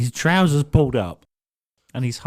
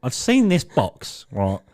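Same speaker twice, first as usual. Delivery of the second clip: usual speaking voice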